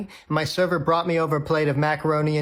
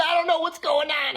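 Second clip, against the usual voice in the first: mock freaking out; 9 semitones higher than usual. [name] under fire on the wheelchair, high mock panic. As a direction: play a comic panic, pitched way up. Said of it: "[name]'s freaking out".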